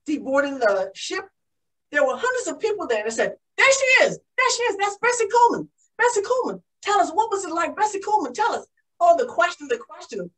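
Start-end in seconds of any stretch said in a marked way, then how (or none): 3.6-8.7 high, eager calling out; excited crowd. Acted. Crowd greeting Bessie Coleman off the ship, high excited clamour. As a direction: call out like thrilled onlookers spotting a celebrity.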